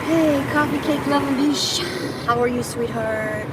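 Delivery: slow, loud greeting. Real, not acted. Greeting chat viewers by name.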